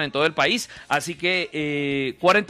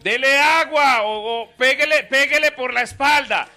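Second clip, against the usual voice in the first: vigilante gritando; 9 semitones higher, 7 dB louder than usual. Acted. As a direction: yell urgent orders from afar, pitched high. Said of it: "el vigilante gritaba". (vigilante que grita a todo pulmón, alargando las palabras)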